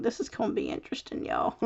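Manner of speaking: breathy whispered aside; whispering. Real, not acted. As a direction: whisper it to yourself. Aside while folding with nails.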